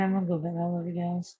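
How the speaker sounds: usual speaking voice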